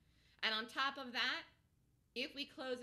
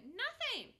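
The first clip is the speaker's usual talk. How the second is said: excited exclamation; 11 semitones higher than usual. Real, not acted. Exclaiming there was nothing.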